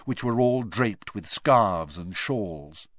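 usual speaking voice

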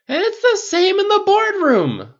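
slow, high, loud rant; outraged cry. A narrator's reading. Pepper venting about work over scotch, outraged cry. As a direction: shout it out, drunk and fed up. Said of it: "Pepper cried".

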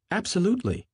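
high, sudden one-word blurt. Acted. Miss Baker suddenly breaking her silence, high sudden blurt. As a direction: pop it out without warning. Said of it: "with such suddenness that I started".

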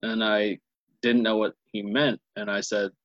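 normal talking voice

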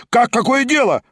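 outraged cry, stronger. A narrator's reading. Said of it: "раздались восклицания".

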